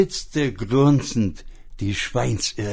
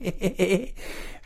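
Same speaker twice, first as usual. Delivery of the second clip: snicker; 8 semitones higher, 5 dB quieter than usual. Acted. Pig laughs mid-threat.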